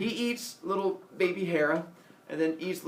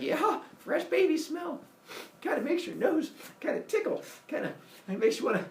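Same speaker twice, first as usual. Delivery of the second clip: high voice with a tickled nose; mock sneeze coming; 12 semitones higher than usual. Sniffing the baby until the nose tickles, high mock pre-sneeze voice. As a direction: stretch it, then wobble toward a sneeze.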